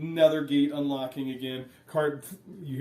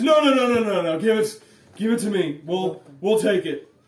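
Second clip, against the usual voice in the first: higher, louder hurried no-no-no; eager guard; 6 semitones higher, 10 dB louder than usual. Acted. Guard stops her taking the lunch, eager and loud. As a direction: grab at the food eagerly. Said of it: "He says".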